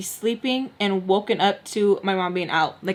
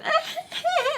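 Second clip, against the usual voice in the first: high fake giggle; put-on high-pitched laughter; mock laugh; 4 dB quieter than usual. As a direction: mimic someone cracking up, mockingly. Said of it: "my mom was laughing".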